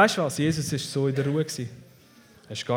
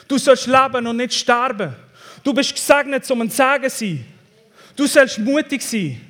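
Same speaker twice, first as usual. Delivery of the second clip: high, loud, declaring sermon voice; full-force preaching; 7 semitones higher, 7 dB louder than usual. Real, not acted. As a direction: proclaim it boldly to the congregation. (kraftvoll gepredigt)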